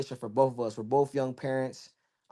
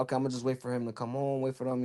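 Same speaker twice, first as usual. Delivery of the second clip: hushed to himself; 8 dB quieter, faster than usual. Retold or imitated. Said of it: "I'm like".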